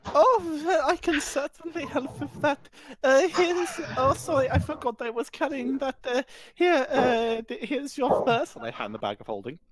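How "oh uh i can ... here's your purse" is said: high, prim posh woman; lightly voiced posh woman fussing; posh lady voice. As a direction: play the flustered refined lady handing over a purse. Player voices posh woman character.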